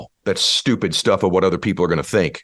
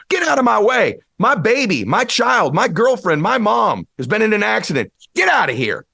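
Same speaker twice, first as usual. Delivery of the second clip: frantic shout. Retold or imitated. Parent rushing to a hurt child: frantic high shout. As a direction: shove your way out yelling for your family. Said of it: "would you go".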